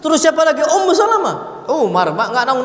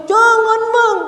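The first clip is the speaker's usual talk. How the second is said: high, loud mock protest; imitated forbidding wife; 13 semitones higher, 4 dB louder, much slower than usual. Wife forbidding her husband's gift to his mother, mock protest. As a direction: play a wife loudly objecting in a high voice. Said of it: "dilarang oleh siapa? Istrinya".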